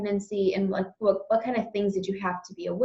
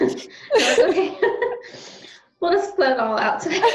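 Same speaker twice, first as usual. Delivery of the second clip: comforting laugh; 9 semitones higher than usual. Real, not acted. Host laughing kindly to comfort her crying guest. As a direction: laugh gently to ease her tears.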